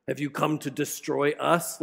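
low eerie demon question; scary demon voice. Acted. Preacher voicing the demon in the synagogue, low and eerie. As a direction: menace the line like a horror villain. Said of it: "really scary, awful voice".

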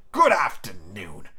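low, drawn-out farewell; Scrooge, curt dismissal. Acted. Scrooge dismissing his nephew, low slow voice. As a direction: slowly shut the talk down, low.

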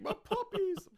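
very high-pitched, hammed-up delight; mock doting squeal. Hitler spotting his puppy puzzle, high mock doting squeal.

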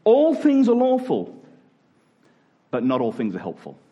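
usual speaking voice

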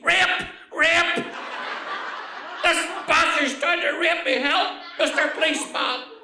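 put-on high, loud old-lady shriek for help; screamed. Old lady pinned down, screaming to the policeman. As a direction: scream out in outraged alarm. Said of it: "the old lady screamed".

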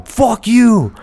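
hammed-up passerby yelling at nobody; imitated street yell. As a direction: shout it up high like someone on the street. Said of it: "someone outside going".

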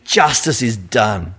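slow, low relish; satisfied. Retold or imitated. Driver watching the speeder get caught, slow relish. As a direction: savour the moment of payback. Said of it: "and you go".

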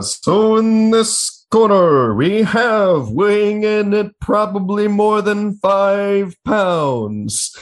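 big booming ring announcer, drawn out; slow hyped fight-night intro; wrestling announcer. Pretend. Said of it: "in an announcer voice".

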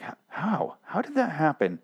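quietly dumbfounded; amazed. Acted. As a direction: ask it in hushed mock wonder. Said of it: "You're almost, like, amazed".

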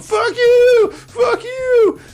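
hammed-up furious yell, voice cracking; imitated screaming rant. Mocking the recovered hero smashing mirrors, screaming. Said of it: "smashing mirrors, going".

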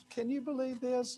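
high, thrilled disbelief; excited fishermen. Retold or imitated. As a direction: play the fisherman amazed at the catch. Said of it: "They were all excited going".

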